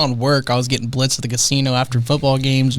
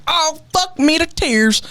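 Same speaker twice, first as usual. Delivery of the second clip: much higher, slow strained cry of pain; hurt golfer; 14 semitones higher, slower than usual. Retold or imitated. Golfer hit in the ankle by a practice swing, slow high pained wail. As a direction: wail it like you just got whacked in the ankle. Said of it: "and the guy goes".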